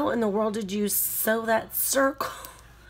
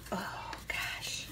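whispered under the breath; 12 dB quieter than usual. Real, not acted. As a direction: breathe it out in awe. Quiet reaction to note.

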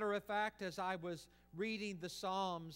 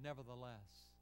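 quiet surrender; 5 semitones lower, 8 dB quieter than usual. Acted. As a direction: give in gently, letting go. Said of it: "as his Son said".

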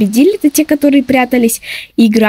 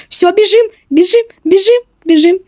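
urgent; 6 semitones higher than usual. Real, not acted. Running from seeker.

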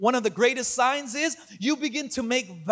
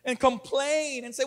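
usual speaking voice

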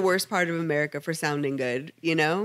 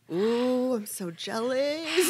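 higher, slow gush of playful jealousy; mock envy; 5 semitones higher, much slower than usual. Co-host envying a traveling hooker, playfully jealous. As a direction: draw it out enviously, with a laugh. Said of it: "I'm like".

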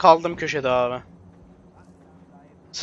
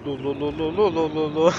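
soft rhythmic mouth noise; imitated gun sound; 3 semitones lower, 4 dB quieter than usual. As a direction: mimic a silenced gun, dul dul. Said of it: "burada dul dul yapıyoruz".

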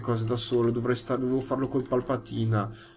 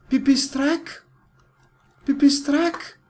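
calling out; 12 semitones higher, 9 dB louder, slower than usual. Real, not acted. Calling the lost bat.

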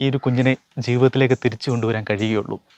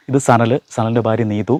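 ordinary talk, nothing marked

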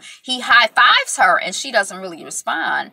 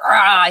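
short loud frustrated roar; frustrated growl; 8 dB louder than usual. Retold or imitated. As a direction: let out a mock frustrated rah. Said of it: "he's like".

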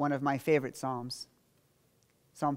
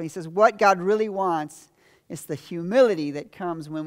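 loud, higher, driving sermon voice; full-force preaching; 5 semitones higher, 6 dB louder than usual. Acted. Pastor drives home David's psalm, preaching at full force. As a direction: preach it out to the congregation.

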